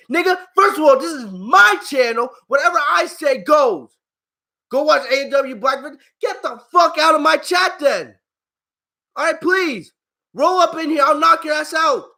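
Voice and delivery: real heated telling-off, pitched high; angry rant. Real, not acted. Streamer telling off a troll in the chat, angry rant. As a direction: tear into the heckler, voice riding high.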